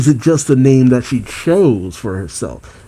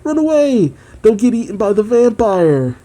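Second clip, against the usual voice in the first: high-pitched mock cry of kids fleeing; much higher kids' warning shout, no real volume; children calling out; 9 semitones higher than usual. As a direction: call it out high like a taunting kid. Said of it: "The kids say".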